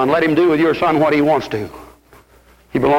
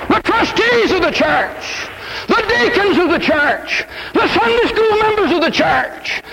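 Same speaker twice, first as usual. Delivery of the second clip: preaching at full force; 10 semitones higher than usual. Acted. Preacher rousing his congregation to prayer and preaching, full-force sermon cry. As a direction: call the whole church to prayer.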